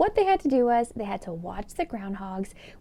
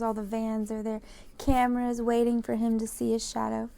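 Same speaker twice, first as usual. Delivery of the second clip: ordinary delivery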